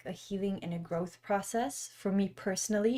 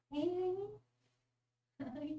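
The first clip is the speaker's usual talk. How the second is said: very soft, coaxing hello to a dog; hushed affectionate dog talk; tender greeting; 8 semitones higher, 11 dB quieter, slower than usual. Real, not acted. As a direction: greet your sleepy puppy gently. Talking tenderly to her dog.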